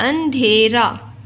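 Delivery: अंधेरा loud, drawn out in syllables; slow model pronunciation. Shown as a demonstration. Teacher models the word for learners, अंधेरा loud and slow. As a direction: stretch अंधेरा slowly and firmly.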